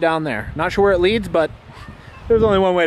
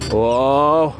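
ordinary delivery

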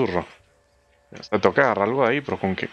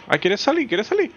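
much higher, cooing questions; mock coaxing; 12 semitones higher than usual. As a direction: tease it in a high pet-talk voice. Teasing the screaming game creature. (tono agudo de burla cariñosa)

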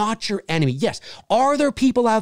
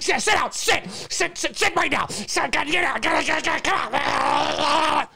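mock commands to dog; 6 semitones higher, slower than usual.

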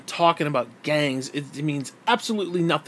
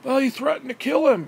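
mocking excuse; 9 semitones higher than usual.

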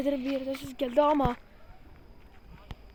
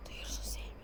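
whispering; 20 dB quieter, faster than usual. Real, not acted. Hushed ghost hunt, hearing sounds.

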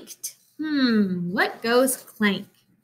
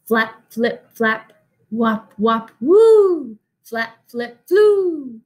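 flapping wing sounds; 5 dB louder, slower than usual. Acted. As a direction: make the swooping wings swell up. Wing sound effect, swelling at woo.

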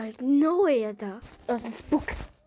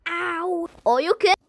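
ordinary talk

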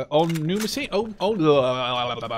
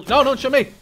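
alarmed outburst; 10 semitones higher, 9 dB louder than usual. Real, not acted. Enemy about to spot him.

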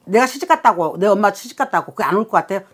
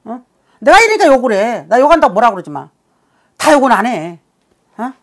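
ordinary talk

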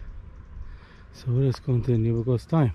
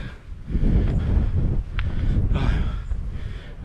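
long tired sigh. Real, not acted. Tired hiker after 16 km.

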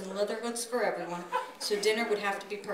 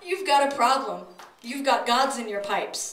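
louder, hammed-up suave visitor; Bob's voice; 3 semitones higher, 7 dB louder than usual. A narrator's reading. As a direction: announce the problem loudly like a smooth talker. Narrator voices Bob.

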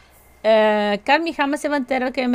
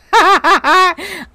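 real laugh; 6 semitones higher, 8 dB louder than usual. Real, not acted. Laughing at anonymous letter mixup.

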